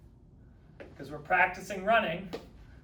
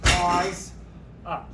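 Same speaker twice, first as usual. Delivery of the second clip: loud coaching cue; 7 dB louder than usual. Shown as a demonstration. Coach calling out pause to the class, loud and firm. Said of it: "We're gonna do a pause".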